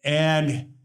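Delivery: ordinary delivery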